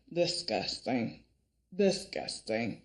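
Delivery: low, slow, hushed disgust. Real, not acted. Reviewer giving a verdict on Brooke and Bill, disgusted. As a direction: draw the word out low and quiet.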